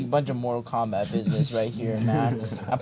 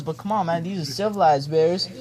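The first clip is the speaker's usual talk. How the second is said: ordinary talk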